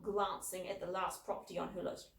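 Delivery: ordinary delivery, nothing marked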